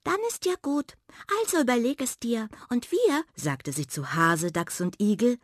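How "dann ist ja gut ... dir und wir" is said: high-pitched little mouse, satisfied; Waldmaus, zufrieden. Acted. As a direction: speak as a high-voiced, self-assured mouse. Mouse voiced, narrator names her. (hohe, zufriedene Mäusestimme)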